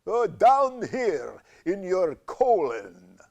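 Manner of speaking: a sly creature in the belly; cancer voice. Acted. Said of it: "where is that voice coming from".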